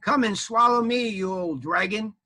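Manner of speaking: high, loud dare; taunt. Acted. Knight daring the dragon, loud taunt. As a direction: call the dragon out.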